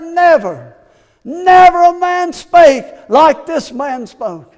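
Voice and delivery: loud, slow, high proclamation; proclaimed. Acted. Officers telling authorities of Jesus, loud slow proclamation. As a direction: ring it out in awe. Officers' awed reply, preached big.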